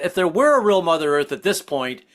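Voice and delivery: ordinary talk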